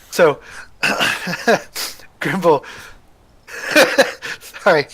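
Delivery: giggling through the words; laughing too hard to get the name out; cracking up mid-line. Real, not acted. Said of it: "I'm laughing at my own internal jokes".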